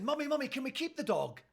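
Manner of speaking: put-on high-pitched pleading child; imitated child's plea to parent; Sharky. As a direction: beg like an eager little daughter. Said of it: "My youngest daughter, Sharky, said".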